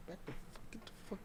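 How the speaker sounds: hushed, breathy curse under the breath; whispered mock dismissal; whispered brush-off. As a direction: hiss it as if shooing someone off quietly. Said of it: "He told me".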